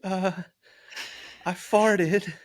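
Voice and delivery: put-on high, small, sheepish kid voice; little son's voice. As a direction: squeak it out like a guilty seven-year-old. Dad voicing son's confession.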